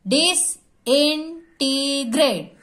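Disintegrate in four slow separate beats; teacher counting out the syllables of disintegrate; split into syllables. Shown as a demonstration. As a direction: sound out disintegrate in four bits. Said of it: "four bits में हम इसको पर".

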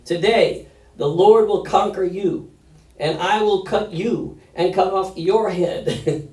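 loud, high declamation as David; forceful scripture reading. Acted. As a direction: proclaim it defiantly to the giant. Dramatic reading of David's reply.

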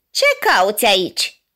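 a lightly voiced giant woman, a touch of menace; thundering giantess. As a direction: demand it like a huge woman at the gate. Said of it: "un glas tunător". (glas tunător de uriașă)

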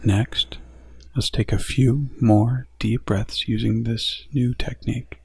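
gentle meditation guide growing hushed; guided meditation. Acted.

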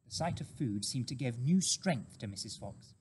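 ordinary delivery, nothing marked

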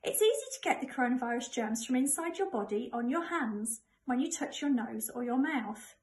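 plain reading voice

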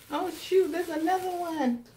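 high, loud, delighted surprise; surprised. Real, not acted. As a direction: caught off guard by another gift.

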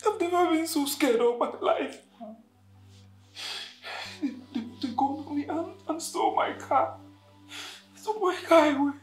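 high, tearful, shaken account; distressed. Acted. Victim telling Rose of the armed robbery, distressed and tearful. Recounts being robbed at gunpoint.

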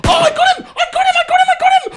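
very high, rapid victory shrieks; triumphant screaming. Real, not acted. Player downing an enemy, screaming in triumph. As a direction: squeal it over and over in excitement.

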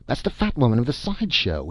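acted shock, breaking off and restarting; gasping exclamation. A narrator's reading. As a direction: stammer it out in shocked disbelief. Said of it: "looked toward Syrilla and gasped".

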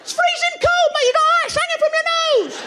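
high, loud, scolding; high loud scolding mate; high mock mate. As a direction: tell off your mate.

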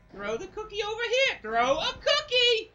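high, loud order building to a yell; frustrated yell at a game character; exasperated shouting. Real, not acted.

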